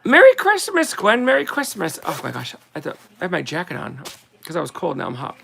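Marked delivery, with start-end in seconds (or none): 0.0-2.0 high bright greeting; calling out. Real, not acted. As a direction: call it across the room.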